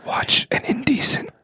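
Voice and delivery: breathy ASMR whisper. Pretend. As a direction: whisper every word close. Parody whispered movie ads.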